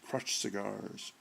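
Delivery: ordinary delivery, nothing marked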